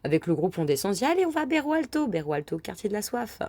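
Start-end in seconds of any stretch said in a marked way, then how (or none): 1.1-2.1 high, eager rallying call; excited call. Retold or imitated. Friends deciding to head to Berro Alto, excited call. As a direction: hype the group up to go out.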